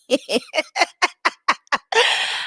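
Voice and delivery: high cackling laugh; loud high laugh. Real, not acted. Laughing at the quote.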